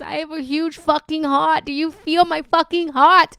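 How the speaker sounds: near-screaming about her big heart; passionate shout. Real, not acted. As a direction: belt it out to the room. Passionate outburst to viewers.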